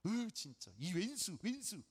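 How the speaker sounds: slow, grinding curse at yourself; mock frustration, half groaned; hammed-up self-blame; self-scolding. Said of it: "내가 나 자신한테".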